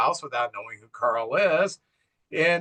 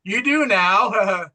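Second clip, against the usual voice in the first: slow, louder mock-gloating line; retold laughing; 5 semitones higher, 4 dB louder, much slower than usual. Neighbor gloating when she begs for help, mock laughing. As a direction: drag it out into a mocking laugh. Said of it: "And he starts laughing. He says".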